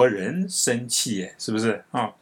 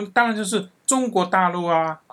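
insistent; 6 semitones higher than usual. Retold or imitated. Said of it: "我那个朋友跟我有点不高兴".

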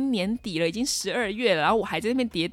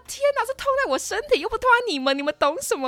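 high and louder, hurt protest; indignant retort; 14 semitones higher, 6 dB louder than usual. Retold or imitated. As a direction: fire back like a wronged kid. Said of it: "然后我就觉得说". (委屈又气愤的高声反驳)

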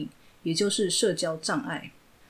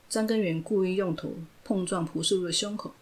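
ordinary delivery

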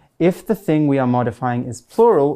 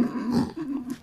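giggle; 12 semitones higher, 10 dB quieter than usual. Shown as a demonstration. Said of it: "that's giggling".

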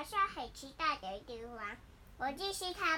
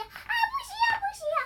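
very high, loud and rushed; fast excited refusal; 9 semitones higher, 10 dB louder, much faster than usual. Acted. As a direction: blurt it out in a rush. Character refusing loudly.

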